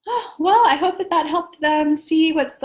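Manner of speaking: high-pitched, upbeat imitation; lightly mimicked happy shrug, pitched well up; Kelly. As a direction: play a breezily unbothered optimist. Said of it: "You see how Kelly's just".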